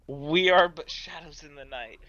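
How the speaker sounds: loud, slow, grandiose proclamation; hammed-up solemn declaration; mock-dramatic ninja. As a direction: intone it slowly like an epic hero.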